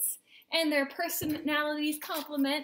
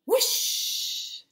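loud, airy whoosh; imitated whoosh; 10 semitones higher, 7 dB louder than usual. A narrator's reading. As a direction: swoosh like something flying past. Imitating sticks flying.

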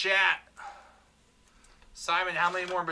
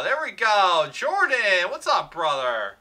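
excited; 5 semitones higher, 7 dB louder than usual. Real, not acted. Hit during live card break.

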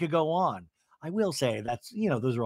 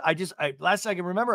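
ordinary delivery

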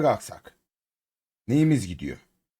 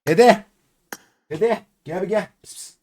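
higher, louder calling out to a pet; calling the cat; 6 semitones higher, 4 dB louder, slower than usual. Real, not acted. Streamer calling the cat Dede over, higher call. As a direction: call across the room to the cat.

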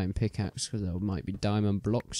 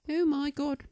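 shrieking high oh my god; high startled squeal. Real, not acted. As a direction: squeal it in shock. Startled by something in game.

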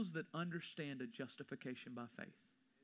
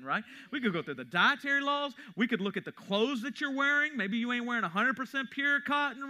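high, loud fiery sermon climax; preaching at full force; 10 semitones higher, 12 dB louder than usual. Acted.

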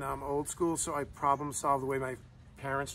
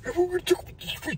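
much higher, fast, airy mimic; high mocking copy of his wife's impression; mocking imitation of wife; 5 dB louder, faster than usual. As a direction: parrot her back in a squeaky put-on voice. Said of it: "Do I talk like that?".

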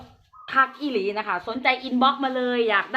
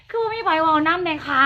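laughing; 5 semitones higher than usual. Real, not acted. Laughs about nobody chatting.

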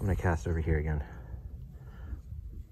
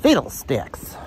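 mock exasperation; 6 semitones higher, 8 dB louder, slower than usual. Real, not acted. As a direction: spit out a quaint curse word. Frustrated at no fishing sign.

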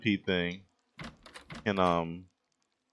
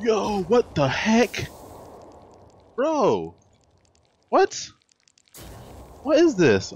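high, loud startled yelps; startled shout; 14 semitones higher, 7 dB louder, much slower than usual. Real, not acted. Gamer startled by a centipede, shouting in shock. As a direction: jump out of your skin and yell.

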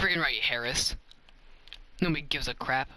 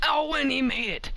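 high-pitched shout of delight; excited celebration; 10 semitones higher, 4 dB louder than usual. Real, not acted. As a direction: call the basket like a thrilled commentator. Celebrating a basket.

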